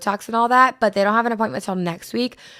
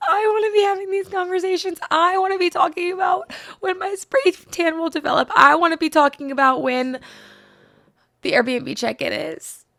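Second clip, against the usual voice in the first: high, whining mock cry; mock whiny wail; 9 semitones higher than usual. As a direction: wail it like a jealous teen. Envious wail at college girls.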